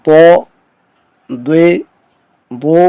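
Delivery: دو said with a thick, heavy sound; thick dhaad. Shown as a demonstration. Teacher contrasting dhaad with daal, thick heavy syllables. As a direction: make the d heavy and full. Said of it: "اور ضاد میں آواز موٹی ہوگی".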